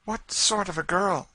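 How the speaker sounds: high, loud eager question. Acted. Lady eager to hear of her brother's wife, high and eager. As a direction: ask eagerly, leaning in. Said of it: "said Madame Desault eagerly".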